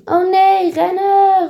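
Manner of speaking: high, loud panicked cry; calling out. Acted. Goes sees the thief, crying out to run. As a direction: shout the alarm and flee. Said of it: "roept Goes".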